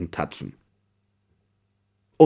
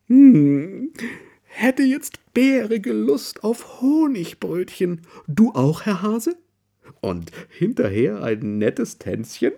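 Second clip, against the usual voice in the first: high, slow, cosy bear voice; Mrs Bear's voice; 11 semitones higher, 3 dB louder, slower than usual. Acted. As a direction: invite him sweetly to buns and a dance. Mrs Bear proposes honey buns.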